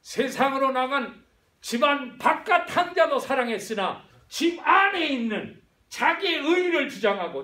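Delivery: loud, high preaching push; preaching shout. Acted. Pastor proclaiming to the church, loud and high. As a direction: proclaim the father's love at full force.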